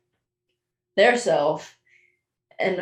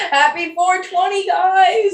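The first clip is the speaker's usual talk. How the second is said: high-pitched, celebratory call-out; excited greeting; 11 semitones higher, 4 dB louder, slower than usual. Real, not acted. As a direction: sing out a festive greeting to listeners. Cheery holiday greeting called to audience.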